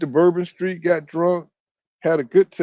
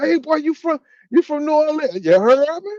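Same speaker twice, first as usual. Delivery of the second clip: New Orleans caller; 10 semitones higher than usual. Retold or imitated. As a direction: ham up the New Orleans greeting.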